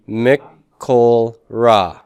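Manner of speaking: Mikol Ra drawn out slowly, stress on Mikol; slow careful reading. Shown as a demonstration. Teacher modelling Hebrew phrase for students, slow pronunciation. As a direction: sound out Mikol Ra slowly for the class. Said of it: "how are we gonna pronounce this?".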